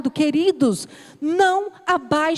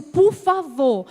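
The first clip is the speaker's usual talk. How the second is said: imploring; much slower than usual. Retold or imitated. Retold plea to church sisters.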